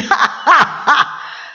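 high mocking hyena cackle; hyena laugh. Hyena leaving the trapped lioness, gleeful cackle. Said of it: "with his stupid laugh".

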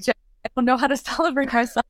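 high, quick, giggly helplessness; laughing protest. Retold or imitated. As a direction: laugh through a retold admission to a coach. Laughingly quoting her own helpless protest.

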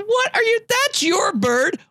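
high, incredulous cry of protest; retold indignant outburst. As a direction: demand what he's doing, outraged. Retold protest over stolen shot.

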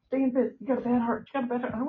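lightly voiced nurse, pitched high; imitated urgent nurse. Nurses ordering him back to bed.

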